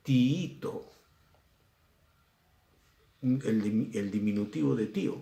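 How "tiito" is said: "Tiito" as ti-Í-to, both i's heard; higher, stretched second i in "Tiito"; double-i hiatus. Shown as a demonstration. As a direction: separate the two i sounds of "Tiito". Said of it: "dos vocales cerradas".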